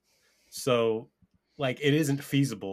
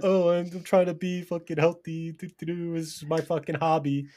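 higher, whiny mock cyclist voice; 6 semitones higher than usual. Repeating the mock on request, in a higher whiny voice. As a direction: sneer it like a smug gym cyclist, lightly. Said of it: "What was that starting off? What you said?".

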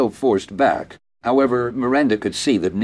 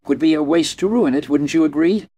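firing back; 7 semitones higher, 4 dB louder than usual. A narrator's reading. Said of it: "Neil fired back".

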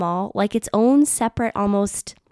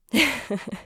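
spontaneous giggle; a short laugh escaping before the point; real laughing; 4 semitones higher than usual. Real, not acted. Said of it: "I don't know why I'm laughing".

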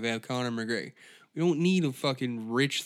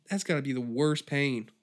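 ordinary talk

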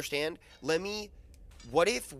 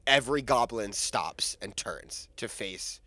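normal talking voice

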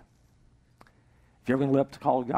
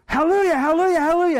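very high, loud, rapid-fire cries; panicked yell; 9 dB louder, faster than usual. Acted. Cowboy racing toward a cliff, yelling in panic. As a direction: scream the stop word in desperation.

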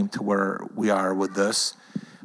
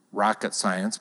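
ordinary delivery

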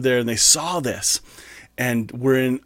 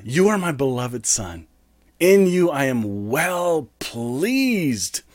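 slow reverent; slower than usual. Acted. Reading God's words slowly.

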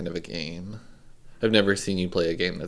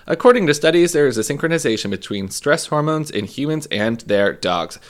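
ad read; 4 dB louder than usual. Acted. Reads a sponsor ad.

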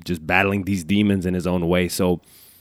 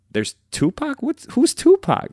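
surprised; 4 dB louder than usual. Retold or imitated. Retelling discovering [name] as a kid.

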